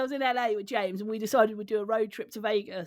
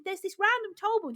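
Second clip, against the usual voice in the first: higher, sheepish mock voice; sheepish reply; 8 semitones higher than usual. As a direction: squeak it out guiltily. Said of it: "I said".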